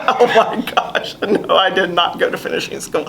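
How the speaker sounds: breathy, amused mock protest, voice dropping; laughing retold protest. Southern cadet answers upperclassman's finishing-school question, laughing mock protest. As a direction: retell the denial through laughter, softening toward the end.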